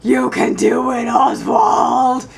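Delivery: loud demonic shout. Pretend. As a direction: bellow it like a possessed demon. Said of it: "In a demonic voice".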